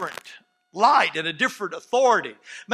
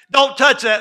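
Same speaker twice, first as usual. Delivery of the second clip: loud, raised scolding; scolding parent; 5 semitones higher, 10 dB louder than usual. Acted. Parent telling a child not to touch, scolding. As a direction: sharply order a child off.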